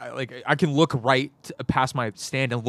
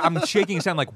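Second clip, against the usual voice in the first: normal talking voice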